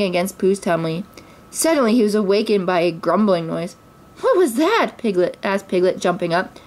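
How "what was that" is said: high, alarmed little voice; high-pitched surprise; startled question. A narrator's reading. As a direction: ask it as if jolted awake. Piglet jumps up at a noise.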